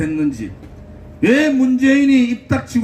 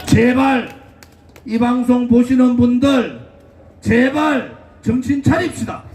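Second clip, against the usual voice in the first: rally speech; 6 semitones higher than usual. Acted. Fiery rally speech to crowd.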